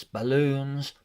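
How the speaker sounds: ordinary delivery, nothing marked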